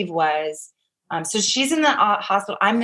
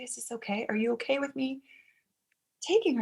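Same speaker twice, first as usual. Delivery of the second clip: hushed, tentative question, pitched up; gentle asking; 4 semitones higher, 8 dB quieter than usual. Retold or imitated. Asking the birth mother before taking her, hushed. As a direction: ask very softly and carefully.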